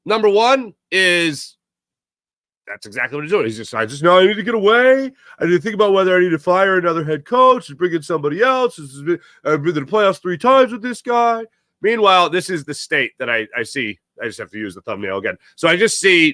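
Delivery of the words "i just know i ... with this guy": mock the owner mulling over firing his coach; imitating the team owner. The imagined owner on vacation musing about his coach, lightly voiced and higher.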